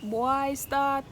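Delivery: ordinary talk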